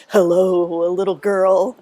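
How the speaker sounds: a hint of a sinister caller; low-key menacing phone voice; creepy man's voice. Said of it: "he's like".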